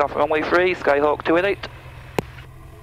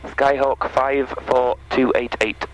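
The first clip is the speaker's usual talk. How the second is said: each word spelled out slowly; careful repeat for clarity; slow and clear; slower than usual. Real, not acted. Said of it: "are you saying two eight".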